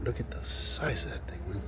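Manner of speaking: whispered in awe; whispered awe at a huge croc; awed whisper. Real, not acted. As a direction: hush it, amazed at the size.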